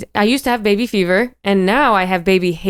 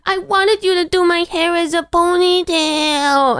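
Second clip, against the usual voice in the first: put-on high, slow little-girl whine; mother retelling a toddler asking for a ponytail, high and slow; 9 semitones higher, slower than usual. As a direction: play the three-year-old pleading. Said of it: "And she's like".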